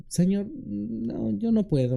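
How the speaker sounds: high, slow reluctant plea; hesitant, whiny protest; Moses making excuses. Acted. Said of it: "pero sí dice".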